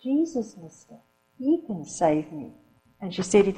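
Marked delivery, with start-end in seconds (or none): 0.0-2.5 quiet, solemn answer; a little girl's solemn reply. A narrator's reading. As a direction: say it gravely and softly. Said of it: "in a solemn voice".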